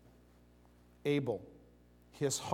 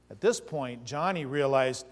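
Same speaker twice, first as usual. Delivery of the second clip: ordinary talk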